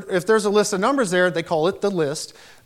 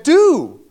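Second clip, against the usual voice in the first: "Do." punched high and loud; stress on Do; 9 semitones higher, 9 dB louder than usual. Shown as a demonstration. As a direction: hit "Do." hard as the command. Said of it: "Jesus said".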